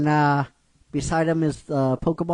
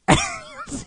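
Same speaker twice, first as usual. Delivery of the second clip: high squealing laugh. Real, not acted.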